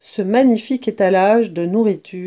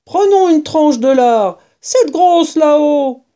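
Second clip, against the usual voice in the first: louder, slow badger voice; greedy badger; 6 semitones higher, 5 dB louder than usual. A narrator's reading. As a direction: point up at the bacon with relish.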